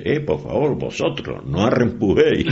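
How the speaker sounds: lightly faked Madrid Spanish with a Canarian slip; returning islander putting on Madrid Spanish; mock Madrid accent. Canarian man back from Madrid fakes a Madrid accent. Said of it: "el tío se vuelve y dice".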